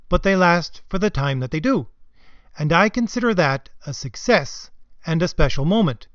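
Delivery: measured imitation of a panel speaker; Sana Amanat's intonation. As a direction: read out her words in her halting intonation. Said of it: "read it in her voice, her intonation".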